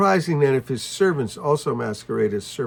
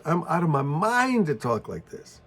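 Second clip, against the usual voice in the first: ordinary talk, nothing marked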